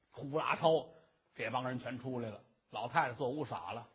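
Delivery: ordinary delivery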